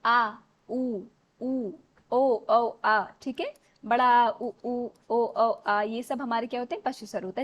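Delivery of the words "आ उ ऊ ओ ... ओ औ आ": "आ, उ, ऊ, ओ, औ" called out loud, one by one; back vowels demo. Shown as a demonstration. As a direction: sound out each back vowel clearly and loudly. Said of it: "जीभ के पिछले भाग से".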